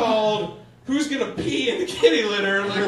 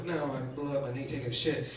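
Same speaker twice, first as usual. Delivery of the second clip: low, quick embarrassed mumble; sheepish mumble; 8 semitones lower, 8 dB quieter, faster than usual. Retold or imitated. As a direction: admit it quietly, ashamed. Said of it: "I'm like".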